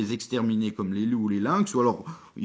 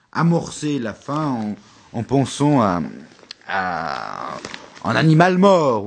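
dramatic build; 2 semitones higher, 10 dB louder than usual. Acted. Dramatic build to dead animal.

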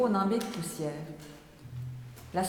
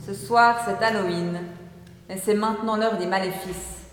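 ordinary delivery, nothing marked

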